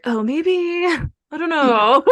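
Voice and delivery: high, slow, drawn-out uncertainty; retold hesitation. Student doubting physical therapy plan, comically unsure.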